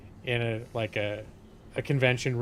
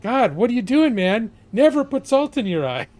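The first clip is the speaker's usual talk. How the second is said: much higher and louder horrified shout; alarmed yelling; 9 semitones higher, 8 dB louder than usual. Retold or imitated. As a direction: shout at him to stop, aghast. Coworkers yell at screaming man.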